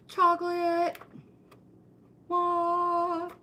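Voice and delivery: high, loud squeal of delight; a hammed-up gasp at chocolate; mock excitement.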